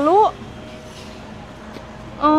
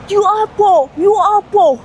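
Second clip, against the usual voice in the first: raised, higher-pitched mock scolding; imitating angry driver; 6 semitones higher, 3 dB louder, slower than usual. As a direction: mimic an angry driver telling someone off. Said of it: "marah-marah". (menirukan sopir marah-marah)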